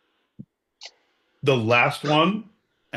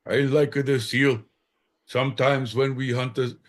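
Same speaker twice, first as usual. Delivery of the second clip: put-on Inuit accent; 3 semitones higher than usual. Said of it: "this is more Inuit".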